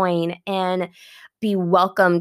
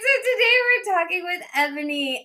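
high-pitched, laughing through the intro; giggly welcome, settling as it goes; real laughing; 14 semitones higher than usual. Real, not acted. Starts interview while cracking up.

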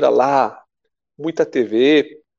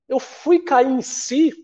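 slow, drawn-out and higher; slow realization; 10 semitones higher, much slower than usual. Real, not acted. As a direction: let it sink in slowly.

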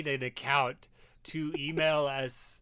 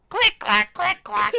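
clicking noise; 11 dB louder than usual. Pretend. Imitating pedal noise.

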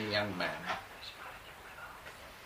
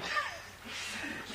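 high airy laugh; 5 dB quieter than usual. Real, not acted. Remembering the devoted young man, a high airy laugh. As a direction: laugh fondly at the memory.

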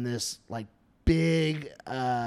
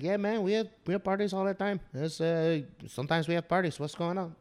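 lightly voiced old Mexican neighbor, higher pitched; easygoing Mexican-accented imitation; 4 semitones higher, 3 dB quieter than usual. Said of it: "like, an old Mexican man".

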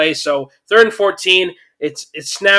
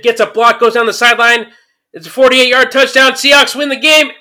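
excited play call; 6 semitones higher, 5 dB louder than usual. Real, not acted. Calling the winning touchdown.

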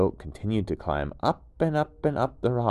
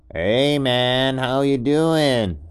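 higher, louder, slow easygoing "hey man" voice; laid-back gecko; 6 semitones higher, 8 dB louder, much slower than usual. Acted. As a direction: chill surfer-dude gecko calling up. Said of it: "the goat heard a noise".

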